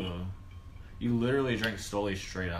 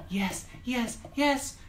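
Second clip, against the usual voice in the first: high, softer, spaced-out yeses; 12 semitones higher, 4 dB quieter, much slower than usual. Retold or imitated. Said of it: "Dairies girls was like".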